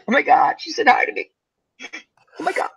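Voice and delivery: whispered giddy oh my God; breathy whispered teen excitement; breathless thrill. Retold or imitated. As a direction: gush under your breath like a smitten kid.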